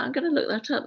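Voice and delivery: normal talking voice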